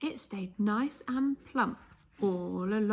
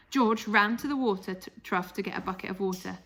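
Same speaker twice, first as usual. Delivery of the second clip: usual speaking voice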